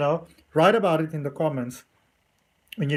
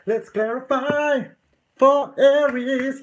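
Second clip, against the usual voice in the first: sing-song intro; 8 semitones higher, 4 dB louder, slower than usual. Acted. Tarot reader introducing the reading.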